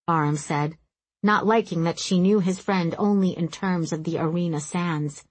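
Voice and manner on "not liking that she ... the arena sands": plain reading voice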